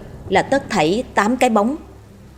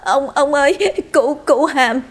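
high, trembling stutter; frightened stammering; 7 semitones higher than usual. A narrator's reading. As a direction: stammer it out breathlessly to an angry master. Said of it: "lắp bắp nói".

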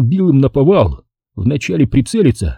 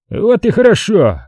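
high-pitched exclamation; exclaiming; 10 semitones higher than usual. A narrator's reading. Drunk colonel answering the narrator's reproach, exclaiming. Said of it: "воскликнул Жора".

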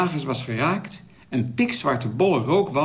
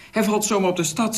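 a mild read-aloud scream, pitched higher; 7 semitones higher than usual. A narrator's reading. Girl sees the plane crash on the city, mild mock scream. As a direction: cry out in alarm, only lightly voiced. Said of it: "schreeuwde het meisje".

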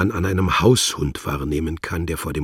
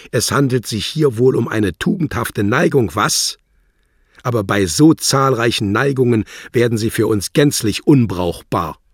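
higher, louder sarcastic diplomat; sneering official; 7 semitones higher, 8 dB louder than usual. Acted. Wladimir sneers at Worlock's inclinations, loud and sarcastic. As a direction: sneer at his virtue.

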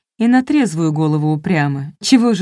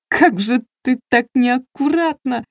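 choking back laughter; 6 semitones higher, slower than usual. A narrator's reading. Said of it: "давясь смехом".

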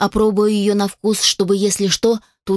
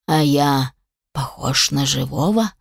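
amused undead creature; 6 semitones lower than usual. A narrator's reading. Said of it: "веселился он".

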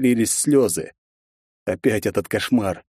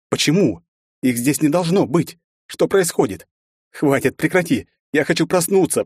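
frightened, higher pleading voice; rising panic, begging to wake; terrified pleading; 6 semitones higher than usual. A narrator's reading. Ужас героини в кошмаре. (испуганно, с нарастающей паникой)

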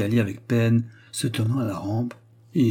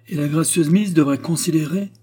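raised voice, higher, louder, calling from behind; bawling; 7 semitones higher, 5 dB louder than usual. A narrator's reading. Delvig bawls at Anna from behind her, higher and louder. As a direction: bawl it at her back. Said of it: "brailla Delvig derrière elle".

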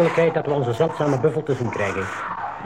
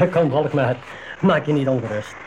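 ordinary talk, nothing marked

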